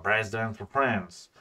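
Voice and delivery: slow, put-on American English accent. Shown as a demonstration. Streamer redoing a dossier title in English, American accent. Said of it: "j'ai oublié mon accent américain".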